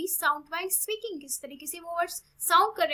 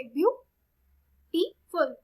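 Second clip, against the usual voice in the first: Beautiful very slow and loud, long gaps between view, टी and फुल; syllable by syllable; 5 dB louder, much slower than usual. Shown as a demonstration. Teacher showing three syllables, beautiful slowly broken as ब्यू-टी-फुल. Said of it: "beauty के अंदर हमारे three syllable".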